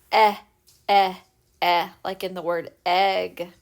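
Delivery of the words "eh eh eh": repeated short "eh"; short e sound. Shown as a demonstration. Teacher to kids, demoing short E. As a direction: sound out "eh" half-open. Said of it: "The short E sound says".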